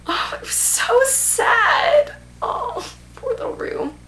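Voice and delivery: high, slow, breaking into a whispered cry; near tears. Real, not acted. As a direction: let the voice crack with sorrow for Rue. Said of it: "It's making me wanna cry right now".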